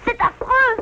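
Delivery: high alarmed cry; frightened cry. Acted. Little beaver hearing the eclipse news, frightened. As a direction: gasp it out, frightened.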